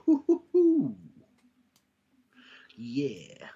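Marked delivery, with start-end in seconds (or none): 0.0-1.0 high, loud "hoo-hoo-hoo"; high-pitched teased hooting; excited hooting. Real, not acted. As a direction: whoop it out high in reaction to a tease. Reacts to co-host's tease.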